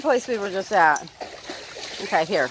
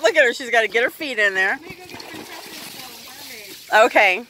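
ordinary talk

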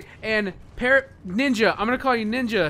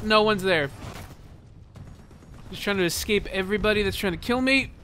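ordinary delivery

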